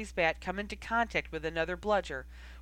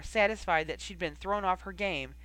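usual speaking voice

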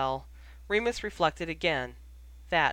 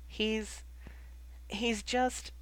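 high, soft, halting search for words; faltering; 5 semitones higher, 6 dB quieter, much slower than usual. A narrator's reading. Ginny looking to Hermione for help, faltering. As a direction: falter and leave it hanging. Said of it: "looking to Hermione now for help".

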